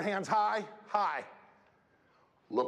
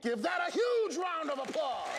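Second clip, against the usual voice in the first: rallying call; 10 semitones higher than usual. Real, not acted. Speaker calling the students to applaud, raised. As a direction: pump up the audience. Rallies the audience.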